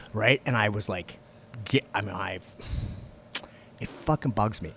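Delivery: normal talking voice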